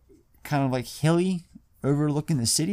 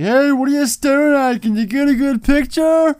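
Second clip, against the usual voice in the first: high, loud mock yell at a stranger; mock angry yell; 13 semitones higher, 8 dB louder than usual. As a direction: bark it out like an offended punk. Steve-O snaps at staring woman.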